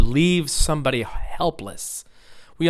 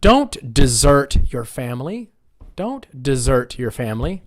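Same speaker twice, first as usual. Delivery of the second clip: desert as di-ZERT; measured model line, desert stressed on ZERT; second-syllable stress. Shown as a demonstration. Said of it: "It's pronounced the same way".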